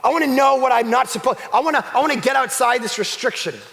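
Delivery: high, loud demanding outburst; a child stamping to be God; petulant rebel. Acted. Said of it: "We go".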